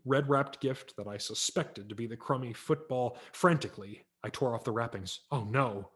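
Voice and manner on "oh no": ordinary delivery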